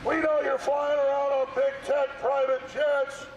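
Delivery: real protester shouting accusations. Real, not acted. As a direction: project every charge at the building. Haranguing the mayor at a protest.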